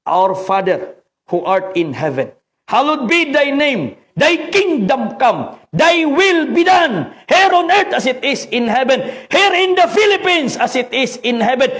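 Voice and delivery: slow, high, ringing call of the Lord's Prayer; proclaimed prayer. Shown as a demonstration. Preacher leading the flock in a slow proclaimed prayer. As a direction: lift the prayer out as a rallying cry. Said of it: "Kaya isigaw natin".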